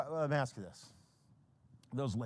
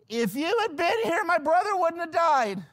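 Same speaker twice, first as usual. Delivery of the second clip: high, loud cry of reproach; dramatic outcry; 13 semitones higher, 7 dB louder than usual. Acted. Mary reproaches Jesus for her brother's death, dramatic outcry. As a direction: wail it out high and loud, hammed up.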